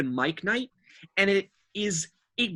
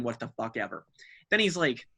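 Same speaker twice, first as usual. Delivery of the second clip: usual speaking voice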